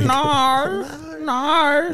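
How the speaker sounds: high, drawn-out Australian "no"; mock Aussie accent. Co-host mocking how Australians sound, hammed-up Aussie accent. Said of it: "no one online who wants an Aussie accent".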